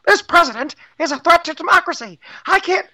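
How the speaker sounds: mock squeaky, very high dubbed voice. Mimicking the parody dub of a news host, high voice. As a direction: race through it in a tiny high-pitched voice. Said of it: "with a high voice like this".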